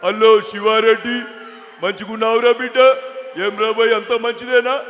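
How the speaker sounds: loud, stretched politician's greeting; వి Hanumantharao impression. Politician greeting his mimic guest at home, hearty impression. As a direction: boom out a drawling hello. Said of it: "Hanumantharao గారి bit ఒకటి చెప్పేసి".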